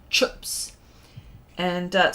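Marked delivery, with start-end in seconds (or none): 0.1-0.7 broad Kiwi "chips", vowel squashed to "chups"; New Zealand accent. Shown as a demonstration. Streamer demonstrating how Kiwis sound different, New Zealand accent. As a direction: say "chips" as a Kiwi's short "chups". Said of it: "or they'll say".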